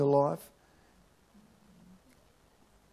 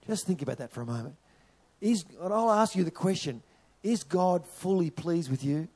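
normal talking voice

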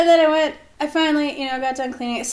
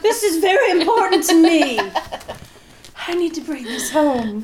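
higher, louder mock-earnest plea; 8 semitones higher, 7 dB louder than usual. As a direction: plead with TSA over a silly souvenir. Pretend plea to TSA.

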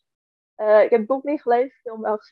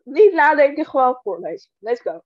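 usual speaking voice

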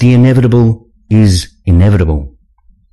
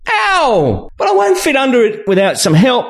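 high-pitched sarcastic complaint; sarcastic female character. A narrator's reading. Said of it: "she says sarcastically".

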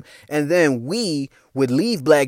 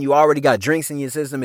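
usual speaking voice

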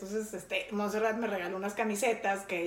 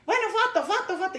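imitated eager fans; 8 semitones higher, 4 dB louder, faster than usual. Fans begging for photos.

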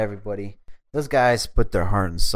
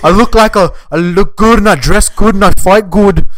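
loud, high-pitched Irish brogue; cocky Irish fighter impression at full volume; Conor McGregor; 10 semitones higher, 10 dB louder than usual. Shown as a demonstration. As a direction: brag in a hammed-up Irish accent. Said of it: "Can you do his accent?".

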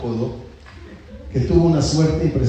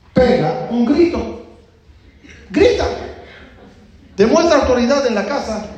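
high, loud, emphatic commands; forceful urging; 10 semitones higher, 4 dB louder than usual. Acted. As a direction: egg him on with raised, punchy orders. Friend coaches henpecked husband to shout. (arenga enérgica, en voz alta y aguda)

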